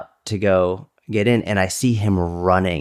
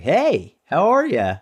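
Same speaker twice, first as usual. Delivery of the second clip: high, chirpy hello; big-smile greeting; 8 semitones higher, slower than usual. Acted. Big guy greeting passers-by, high and smiley. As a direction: sing it out sweetly through a big smile. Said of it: "with a big smile on my face".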